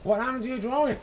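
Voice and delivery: high, bright-pitched teacher's voice; teacher's question. Acted. Teacher asks Regina about her torn drawing, high and lively.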